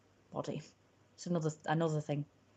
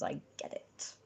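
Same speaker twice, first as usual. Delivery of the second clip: soft whispered aside; 11 dB quieter than usual. Acted. Streamer confiding to viewers, in a whisper. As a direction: whisper it conspiratorially.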